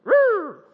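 high whoop of a police siren; police siren blip. Retold or imitated. Storyteller imitating the police car behind him, siren blip. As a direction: mimic a short, loud siren blip. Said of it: "I heard this sound from the movies".

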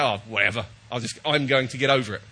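resigned, letting it go; dismissive shrug. Acted. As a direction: brush it off with a low whatever. Voices shrugging off an irritation.

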